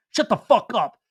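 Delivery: acted-out quarrel; retold angry outburst. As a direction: punch each word in mock fury.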